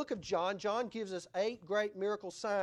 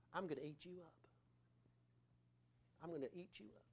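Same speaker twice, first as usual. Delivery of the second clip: very soft, imitating a doting parent; hushed baby talk; 3 semitones lower, 14 dB quieter, faster than usual. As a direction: murmur it playfully to a baby.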